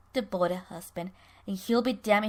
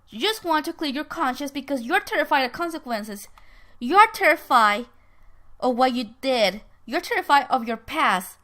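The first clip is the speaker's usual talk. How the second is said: heated, louder acted accusation; accusing; 2 semitones higher, 5 dB louder than usual. Ben confronting Herb about fearing consequences, accusing. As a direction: confront them, driving each charge home.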